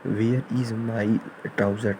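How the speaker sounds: extremely slow dictation; slow example. Shown as a demonstration. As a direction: drag each word for copying. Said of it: "example sentence said slowly". (बहुत धीमे बोलना)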